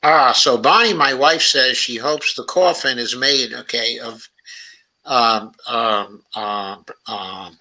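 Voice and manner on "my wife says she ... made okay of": ordinary talk, nothing marked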